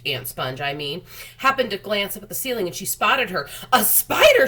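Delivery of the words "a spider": high, loud shriek of alarm; cried out. Acted. Aunt Sponge spotting the spider, shrieking loudly. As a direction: shriek it out, much higher and louder. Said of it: "she cried".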